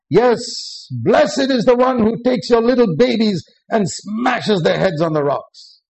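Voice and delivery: vengeful fervour. Acted. Preacher reads vengeful psalm aloud.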